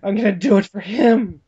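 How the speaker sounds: hammed-up heroic outcry; [name]. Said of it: "she points at the empty bed".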